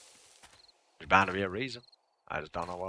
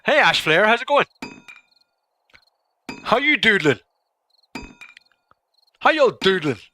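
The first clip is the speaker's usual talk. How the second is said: high, loud sing-song hello; loud, high playful greeting to a viewer; goofy high greeting; 12 semitones higher, 10 dB louder than usual. Pretend. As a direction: greet Ash Flare in a silly high voice.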